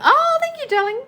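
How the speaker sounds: delighted thanks. Real, not acted. Host thanks a fan for the compliment, high delighted squeal. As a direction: squeal a happy thank you.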